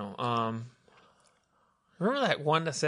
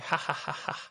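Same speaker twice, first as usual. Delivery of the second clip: soft, voiceless spaced-out laugh; mock self-satisfied chuckle on bare breath; imitated laugh; 5 dB quieter than usual. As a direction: laugh like the king of jokes, quietly. Imitating himself as joke king.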